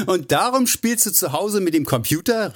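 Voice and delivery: incredulous, amused high cry; laughing exclamation. A narrator's reading. Hugh teases Drew about playing at home, laughing. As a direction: burst out laughing, high-pitched question. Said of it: "rief Hugh lachend aus".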